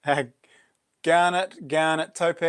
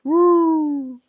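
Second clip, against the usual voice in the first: excited whoop; 12 semitones higher, 5 dB louder than usual. Real, not acted. Cheering about a find.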